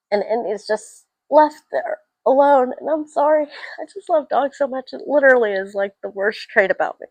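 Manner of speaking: high, tearful voice; choked up. Real, not acted. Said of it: "I'm gonna cry".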